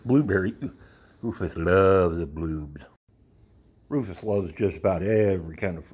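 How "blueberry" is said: ordinary talk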